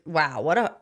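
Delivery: ordinary talk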